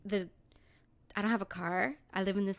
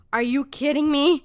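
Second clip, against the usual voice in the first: loud, incredulous retold outburst; indignant loud disbelief at the teacher; outraged disbelief; 4 semitones higher, 9 dB louder than usual. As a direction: blurt it out in shock. Class let out, attendance not taken.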